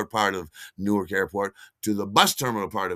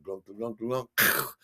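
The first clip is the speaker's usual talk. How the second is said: gulping noise; slower than usual. Retold or imitated.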